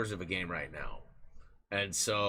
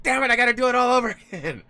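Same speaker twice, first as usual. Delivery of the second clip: loud, fast, much higher self-mock with a laugh; exasperated outburst; 12 dB louder, much faster than usual. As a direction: blurt it out in a laughing panic. Quoting his own end-of-month panic.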